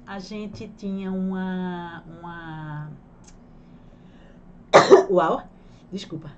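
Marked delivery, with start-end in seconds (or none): 5.1-5.5 loud sudden sneeze, then a gasp; sneezing. Real, not acted. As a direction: sneeze mid-sentence, then uau. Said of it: "vai ter espirro no meio".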